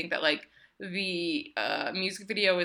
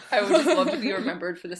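laughing; 3 semitones higher, 6 dB louder, faster than usual. Real, not acted. Joking about being a saint.

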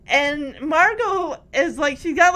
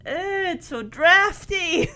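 slow, higher mock whine of discomfort; imitating a kid's mild dismay; squirmy complaint; 4 semitones higher, slower than usual. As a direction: draw it out as a playful grumble. Voicing girl uncomfortable in a dress.

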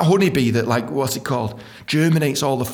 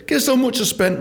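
normal talking voice

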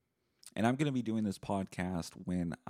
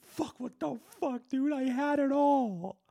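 very high, strained whine of a washed-up self; future self lament; 4 dB louder, slower than usual. Retold or imitated. Future self watching old podcast, whining high. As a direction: whine like a future loser mourning lost luxuries. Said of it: "I'll be like".

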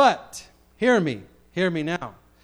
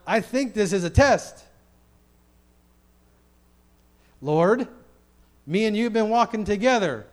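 raised, higher preacher's voice; impassioned preaching; 6 semitones higher, 6 dB louder than usual. Acted. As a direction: preach it emphatically to the Lord.

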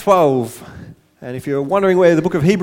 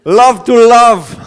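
loud, slow, higher-pitched Jamaican accent; 5 semitones higher, 8 dB louder, much slower than usual. Shown as a demonstration. Preacher leads the congregation saying the title, Jamaican accent. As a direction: belt it out slowly in a put-on Jamaican accent. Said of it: "let's all say it with a Jamaican accent".

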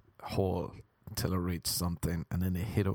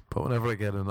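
usual speaking voice

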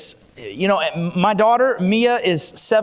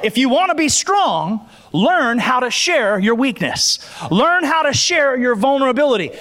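preaching at full force, raised and high; 4 semitones higher than usual. Acted. Pastor preaching to the church about testimonies, full force. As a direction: fire up the congregation from the pulpit.

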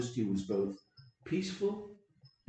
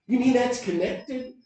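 ordinary talk, nothing marked

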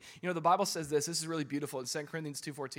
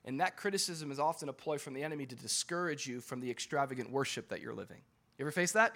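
ordinary delivery

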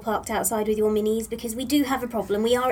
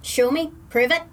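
put-on Irish accent; 3 semitones higher, 5 dB louder than usual. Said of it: "adopt Irish accents".

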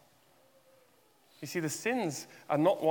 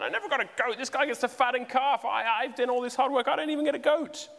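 aggrieved elder brother; 8 semitones higher than usual. Retold or imitated.

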